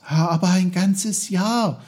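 put-on high, loud boy's protest; exclaiming. A narrator's reading. Boy protests to his uncle about the lost year, exclaiming. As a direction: cry out in disbelief as a child. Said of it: "rief Peter".

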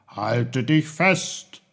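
slow, drawn-out call of warning. A narrator's reading. Uncle on the boy's shoulder before flight, calling out. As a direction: call it slowly before taking off. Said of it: "rief er".

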